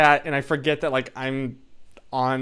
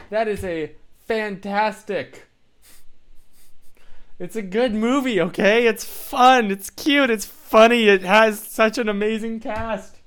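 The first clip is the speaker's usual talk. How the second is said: higher, louder insistent praise; enthusiastic defense; 7 semitones higher, 5 dB louder than usual. Real, not acted. Streamer defending Megamind to chat, higher louder enthusiasm. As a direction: insist on every point.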